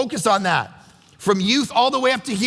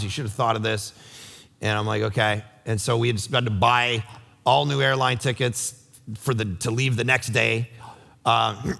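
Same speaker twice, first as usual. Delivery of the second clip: much lower, hushed-down storytelling; subdued, earnest; 11 semitones lower than usual. Real, not acted. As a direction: drop low and tell it gently and sincerely. Earnest story of friend's stroke.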